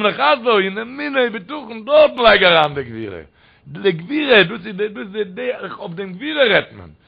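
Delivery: high, sustained sermon singsong; impassioned preaching. Acted. Preacher expounding the verse to the crowd, impassioned singsong preaching. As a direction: drive each phrase up high, then let it fall.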